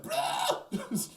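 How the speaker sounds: high, loud critter squawk; squeaky creature cry. Retold or imitated. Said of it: "they go like".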